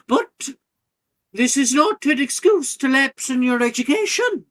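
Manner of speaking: high, loud headmistress over a noisy hall. Acted. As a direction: call the excited students back to order. Headmistress reining in an excited hall.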